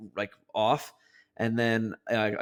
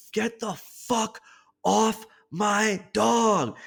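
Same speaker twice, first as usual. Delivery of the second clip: retold scream at dog; 10 semitones higher, much slower than usual. To the dog locked on his dog's neck, a high slow yell. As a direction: scream it at the biting dog, stretched out. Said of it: "And I was like".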